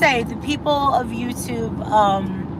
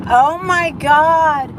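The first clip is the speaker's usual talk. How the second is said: higher, louder, slow shocked "Oh my God"; shocked gasp; 7 semitones higher, 5 dB louder, much slower than usual. Retold or imitated. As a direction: let the shock stretch the words out. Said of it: "And I went".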